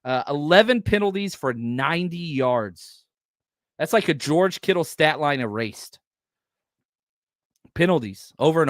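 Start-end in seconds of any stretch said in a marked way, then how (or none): none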